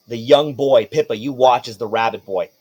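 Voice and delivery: ordinary talk, nothing marked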